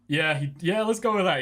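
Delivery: excited agreement. Real, not acted.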